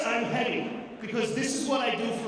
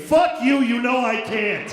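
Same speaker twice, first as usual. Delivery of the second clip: angry retort; 7 dB louder than usual. Acted. Comic snapping at the orange juice box, loud angry retort.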